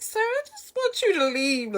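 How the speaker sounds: very high, whiny silent plea; desperate inner plea. Retold or imitated. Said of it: "In my head, I'm like".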